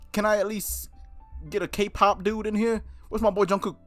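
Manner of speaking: usual speaking voice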